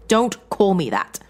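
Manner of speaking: loud, slow, cutting snap; snapped. A narrator's reading. John to his wife, slow furious snap. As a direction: bite each word off. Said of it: "John snapped".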